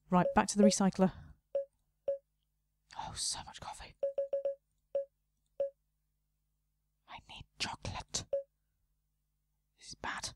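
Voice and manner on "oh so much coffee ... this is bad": hushed, barely audible fretting; worried whisper. Real, not acted. Shop owner fretting about no chocolate, whispered. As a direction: whisper it like a secret worry.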